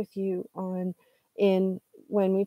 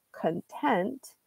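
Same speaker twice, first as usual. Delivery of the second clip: content said con-TENT, softly, weight on the end; stress on second syllable; 6 dB quieter than usual. Shown as a demonstration. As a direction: lean on TENT in content, reduce the first syllable. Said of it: "on the second syllable".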